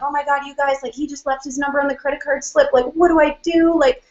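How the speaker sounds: high, breathless excited gush to coworkers; panicking giddily about his number; freaking out. Retold or imitated. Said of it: "freaking out".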